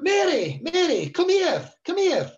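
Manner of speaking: imitating dad calling the wife over; retold shout. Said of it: "And he shouted".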